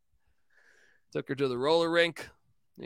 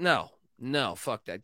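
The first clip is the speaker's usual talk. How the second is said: ordinary delivery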